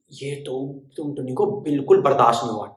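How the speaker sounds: usual speaking voice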